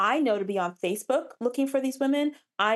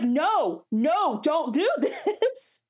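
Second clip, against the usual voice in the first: playfully begging him to stop; laughing mock protest; 6 semitones higher, much slower than usual. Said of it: "And she's like".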